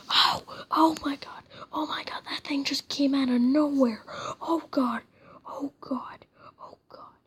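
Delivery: scared loud whisper; startled whisper-yell. Real, not acted. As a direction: gasp and yelp in a whisper. Jump scare from a boss.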